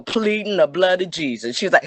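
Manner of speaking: ordinary talk, nothing marked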